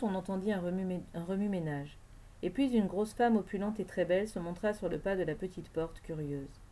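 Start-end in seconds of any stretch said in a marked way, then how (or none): none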